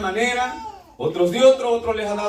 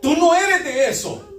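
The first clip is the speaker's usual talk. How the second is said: higher-pitched fatherly challenge; father correcting child; 5 semitones higher than usual. Acted. Preacher shows how he talks to his son, firm fatherly correction. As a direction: question the child in disbelief, pitched up. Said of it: "Así hablo con mi niño".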